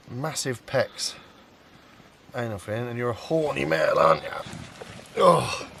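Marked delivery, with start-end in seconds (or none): 2.4-4.3 teasing the fish. Real, not acted. Teasing the male carp. 5.2-5.7 a loud, high, sudden oh; startled oh. Real, not acted. Angler startled when the carp thrashes, sudden loud oh. Fish thrashes in his hands.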